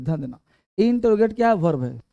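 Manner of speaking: normal talking voice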